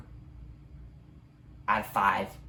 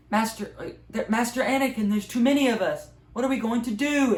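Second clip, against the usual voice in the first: put-on much higher, pleading little kid voice; a child asking Master Anakin for help, high and scared; 11 semitones higher, 5 dB louder than usual. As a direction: beg like a terrified youngling. Frightened youngling pleading with Anakin.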